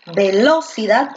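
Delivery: "velocidad" slowed and stressed on the second syllable, ve-LO-cidad. Shown as a demonstration. Teacher testing syllables for the class, "velocidad" wrongly stressed on LO. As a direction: lean hard on LO in "velocidad". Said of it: "Porque tú acaso dices".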